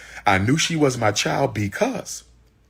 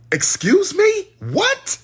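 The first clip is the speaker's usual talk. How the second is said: high pitched, louder excuse me; outraged disbelief; 4 dB louder, much slower than usual. Retold or imitated. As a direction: shriek it in shocked outrage. Outraged at missed birth.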